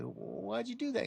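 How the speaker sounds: high, soft, quick wail; small high voice of a frog going under; drowning frog. Acted. Stung frog asking the scorpion why while sinking, mock-plaintive. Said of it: "as he's going down".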